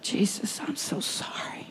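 hushed, broken whisper of apology; whispered repentance. Retold or imitated. Janice whispering her apology to Jesus, hushed and contrite. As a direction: breathe the apology out with no voice. Retold tearful repentant prayer.

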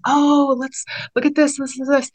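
put-on high, eager kid voice; excited young children. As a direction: imitate children thrilled by a find. Voices young children spotting signs.

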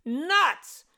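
high-pitched indignant yelling; screaming. A narrator's reading. Jeff upset, screams from between the walls, high-pitched. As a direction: scream short insults, very high. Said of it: "Jeff screamed from his hiding place".

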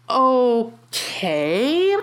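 long, rising, drawn-out "Okay" of confusion; baffled okay. Retold or imitated. Scolded over a missing exclamation point, baffled. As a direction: let it hang, utterly baffled. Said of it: "truly baffled".

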